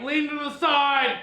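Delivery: high, drawn-out mock shout; retold urgent call. Driver to riders on the slanted hill, mock yell. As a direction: call out like a driver warning passengers.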